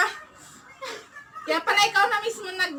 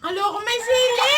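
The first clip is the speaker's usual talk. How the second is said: loud shocked laughing yell; laughing shout; 3 semitones higher, 4 dB louder than usual. Real, not acted. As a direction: shriek and laugh at the chili. Discovers chili in her food.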